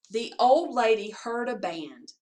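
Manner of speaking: plain reading voice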